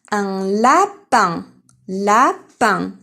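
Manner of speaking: "lapin" slow, in two loud beats; split into syllables. Shown as a demonstration. Teacher dictating to young pupils, "lapin" syllable by syllable. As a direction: punch out "lapin" with a gap between syllables.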